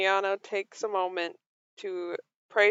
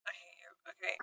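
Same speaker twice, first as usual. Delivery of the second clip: whisper; 4 semitones lower, 20 dB quieter, much faster than usual. Real, not acted. Whispered aside in game chat.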